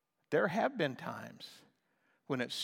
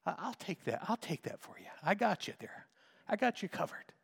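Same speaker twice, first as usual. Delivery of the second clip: gentle Holy Spirit; 5 dB quieter than usual. Retold or imitated. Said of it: "The Holy Spirit reminds me".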